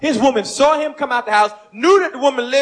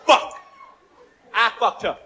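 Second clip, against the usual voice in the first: ordinary talk, nothing marked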